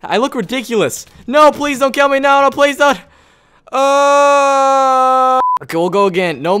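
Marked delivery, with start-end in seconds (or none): 1.3-3.0 high, loud mock begging for life; panicked pleading. Pleading as he is attacked in game. 3.7-5.4 long high loud wail of defeat; drawn-out wail. Real, not acted. Killed in game after pleading.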